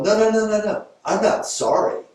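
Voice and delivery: high, loud rapid string of no's; emphatic denial. Retold or imitated. Therapist correcting client, high emphatic protest. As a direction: insist you're not sorry.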